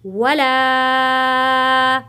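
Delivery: वला with the ला held long, three beats; वला, the long vowel pulled out to three counts; stretched vowel. Shown as a demonstration. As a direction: draw out the ला of वला slowly. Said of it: "तीन अलिफ के बराबर खींच कर पढ़ना है".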